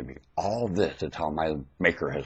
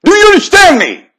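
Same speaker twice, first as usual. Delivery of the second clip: fiery preaching; 18 dB louder than usual. Real, not acted. Preacher demanding answers from congregation, fiery preaching. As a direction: drive each question home to the crowd.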